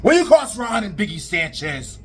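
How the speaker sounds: high, proclaiming threat; wrestling promo. Acted. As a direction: pick the promo back up grandly.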